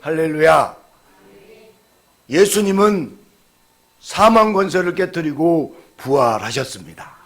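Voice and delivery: loud, high, ringing proclamation; full-force preaching. Acted. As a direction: proclaim it to the whole congregation. Opening Easter proclamation. (힘찬 설교조)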